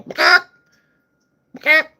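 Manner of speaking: loud squawking bawk, jumping high on the second; chicken squawk. Retold or imitated. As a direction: cluck like a startled chicken. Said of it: "a fucking chicken pecking at some seed going".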